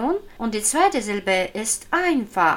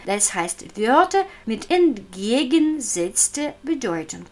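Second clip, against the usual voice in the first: ordinary talk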